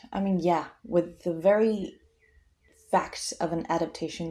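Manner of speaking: ordinary talk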